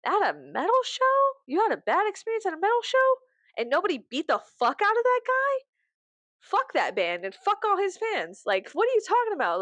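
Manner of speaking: high-pitched, indignant disbelief; mock incredulity. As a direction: question it as if it's utterly absurd. Acts disbelief at bad-experience stories.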